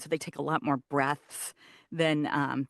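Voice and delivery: ordinary talk, nothing marked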